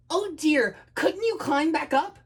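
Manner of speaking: high, worried little dragon; anxious, helpless suggestion; fretting. Acted. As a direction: fret in a high voice. Timid dragon frets over boy.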